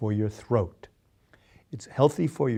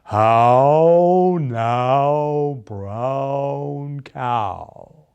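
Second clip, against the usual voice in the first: louder start, open vowels; elocution drill; 5 dB louder, much slower than usual. Shown as a demonstration. Coach leads the group in the actors' drill. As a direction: lead the class through it. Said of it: "Say it with me".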